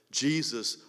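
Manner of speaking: ordinary delivery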